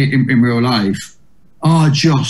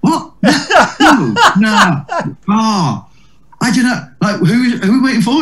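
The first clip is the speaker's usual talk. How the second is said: high, flustered imitation of Eddie; panicky high voice; 8 semitones higher, slower than usual. Comedian mimicking Eddie's panic at filling in, high and flustered.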